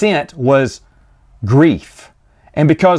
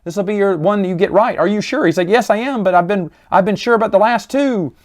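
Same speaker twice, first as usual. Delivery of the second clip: higher, faster earnest urging; urging Obi-Wan; 6 semitones higher, faster than usual. Retold or imitated. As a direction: push him to try once more.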